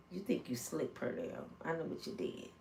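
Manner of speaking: near-whispered sly taunt; teasing hush. Acted. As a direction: let him know you know, hushed. Teasing Pernell, secret knowledge.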